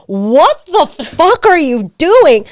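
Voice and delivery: loud, high, drawn-out indignation; slow, incredulous retold outburst; outraged disbelief. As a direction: stretch each word in mock outrage. Retells shocked reaction to cold hand.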